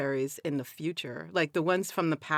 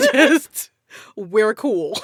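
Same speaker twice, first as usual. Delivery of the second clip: laughing it out, louder and higher; 10 semitones higher, 12 dB louder, much slower than usual. Real, not acted. To the friend joking about haunting, laughing. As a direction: giggle through the words. Laughing reply to ghost joke.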